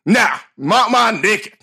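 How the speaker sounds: slow Southern drawl; Southern guest. Retold or imitated. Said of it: "clearly it was from the South".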